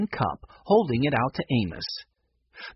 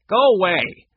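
loud, higher irritated command; acted cross outburst; angry dismissal; 6 semitones higher, 7 dB louder than usual. A narrator's reading. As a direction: send the child off crossly. Mac glares at Benny.

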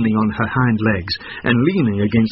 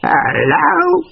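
high, loud goat bleat on hello; 5 dB louder than usual. A narrator's reading. Goat calling down the well, bleating hello. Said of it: "she bleated".

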